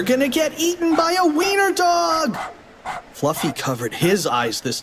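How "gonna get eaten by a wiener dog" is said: high-pitched wail of doom; panicked cry. Acted. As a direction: squeal it to the very end. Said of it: "cried Cheeseball".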